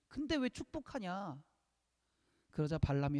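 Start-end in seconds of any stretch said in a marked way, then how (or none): 0.1-1.4 high but softer indignant whine; acted aggrieved complaint. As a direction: play the cheated king, voice high and dropping in volume. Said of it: "화가 난 거예요". (억울한 듯 높고 작게 따지기)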